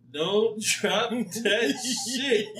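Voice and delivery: laughing warning. Retold or imitated. Old man warning in skit.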